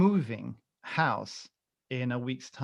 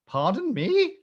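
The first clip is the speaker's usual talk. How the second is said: much higher 'Pardon me?', swooping up at the end; incredulous echo; 10 semitones higher, 4 dB louder, slower than usual. Retold or imitated. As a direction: escalate into hammed-up disbelief on 'Pardon me?'. Repeats the echo, more incredulous.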